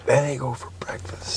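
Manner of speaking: hushed, fully whispered echo. Pretend. Echoing the co-host's breakfast line, whispered. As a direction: whisper it back.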